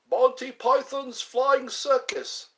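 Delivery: mock announcer.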